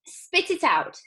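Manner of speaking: high, loud order; urgent command. Acted. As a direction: order it out fast. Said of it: "said Mister Wonka".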